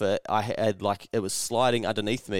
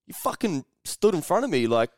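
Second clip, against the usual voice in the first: higher, mildly heated mock telling-off; imagined angry retort; 6 semitones higher than usual. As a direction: act out the accusation you held back. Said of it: "my brain wanted to default to".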